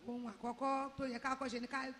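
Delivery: normal talking voice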